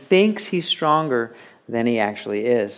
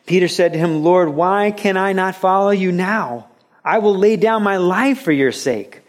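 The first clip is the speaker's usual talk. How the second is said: preached scripture reading; 6 semitones higher, 4 dB louder than usual. Acted.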